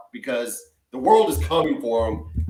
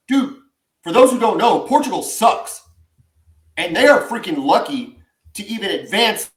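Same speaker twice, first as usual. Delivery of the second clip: heated rant; 5 semitones higher, 4 dB louder than usual. Real, not acted. Ranting about the national team.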